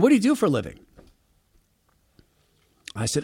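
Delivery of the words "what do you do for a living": higher, friendly neighbor voice; a hint of the chatty neighbor; lightly voiced neighbor. Neighbor voiced asking job.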